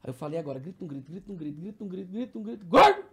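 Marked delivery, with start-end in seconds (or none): 0.4-2.7 quiet, repetitive chant creeping up in pitch; imitated nagging urge. Inner urge building before outburst. 2.7-3.1 sudden high, loud blurt; acted-out involuntary shout; imitated tic outburst. Acted tic outburst after buildup.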